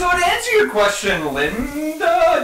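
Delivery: high, loud cartoon mom; Linda Belcher voice. Bob's Burgers character voice.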